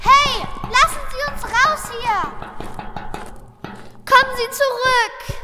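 desperate call. Acted. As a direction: shout after them to come back. Trapped kid calls after captors.

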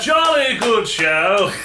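drawn-out, hammed-up British cheer; British gentleman. Ambassador applauding a king-killer, mock British gentleman. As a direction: stretch it out like an approving English gentleman.

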